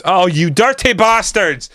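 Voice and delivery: real outburst at the screen, pitched high; slow, high, frustrated yell of dirty bastards; angry outburst. Real, not acted. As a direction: curse them out, high and drawn out. Opponents score against his team.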